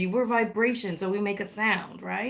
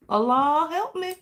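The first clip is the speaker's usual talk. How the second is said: normal talking voice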